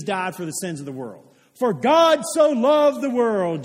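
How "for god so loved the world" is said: loud, high, slow verse proclamation; grand declamation. Acted. Preacher quoting scripture to the congregation, grandly declaimed. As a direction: proclaim it to the whole room, drawing out each word.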